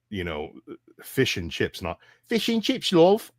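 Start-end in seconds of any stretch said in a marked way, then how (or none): 2.3-3.3 high-pitched put-on English accent; hammed-up British "love", pitched way up; mock English accent. Imagining ordering food in England, pretentious mock English accent. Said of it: "I would go to England".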